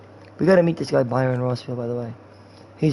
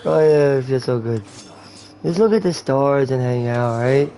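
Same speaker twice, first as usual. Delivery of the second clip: stoned guy. Pretend. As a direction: act high, dragging words and breaking into laughs. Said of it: "That's how you act when you're high".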